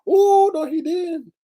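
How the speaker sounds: high, loud oh no he didn't; scandalized. Real, not acted. Vlogger gasping at the pastor's curse, high and scandalized. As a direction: play it scandalized and delighted. Reacting to the cursing clip.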